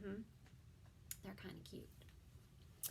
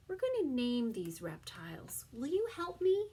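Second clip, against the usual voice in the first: ordinary talk, nothing marked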